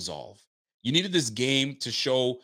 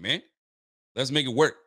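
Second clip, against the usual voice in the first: ordinary talk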